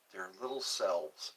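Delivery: normal talking voice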